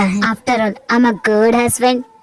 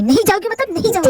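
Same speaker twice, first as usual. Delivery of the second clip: angry insistence; 9 semitones higher than usual. Acted. Husband forbidding wife to leave.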